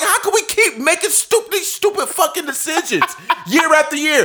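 high, laughing disbelief; laughing exasperation. Retold or imitated. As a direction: laugh through the frustration. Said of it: "I'm like".

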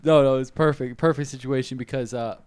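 normal talking voice

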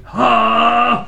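loud, high gasp of a spirit arriving; mock possession cry. Fake medium channeling Jesus, sudden cry. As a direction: cry out as the spirit enters you. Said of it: "I'm getting something".

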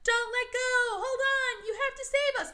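high, pleading mock yell; mock screaming. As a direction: scream for help, hammed up. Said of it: "they're screaming the whole time".

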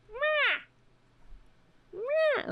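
very high cat meowing; mock cat meows. Host imitating her cat yelling at her, high meows. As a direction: meow like an angry cat yelling. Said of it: "He's like".

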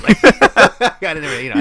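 laughing loudly through the words; real loud laughter. Real, not acted. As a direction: crack up mid-sentence. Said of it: "laughing while telling".

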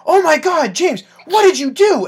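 high, loud scandalized cry; mock outrage. Relatives blaming James for the crying toddler, mock outrage. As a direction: imitate aghast relatives calling him out, voice shooting up. Relatives accusing him, acted out.

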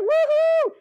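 very high, loud mock cheer; giddy celebration over one apple; excited whoop. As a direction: go wild over a single apple. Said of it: "You get all excited about one apple".

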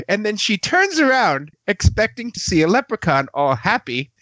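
ordinary talk, nothing marked

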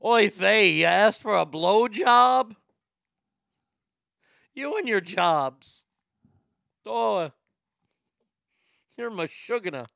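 put-on Yiddish accent. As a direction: scold like a Yiddish mom. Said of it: "she's like".

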